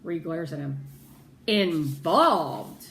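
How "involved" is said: 1 indignant. Acted. Ree glares at Daddy.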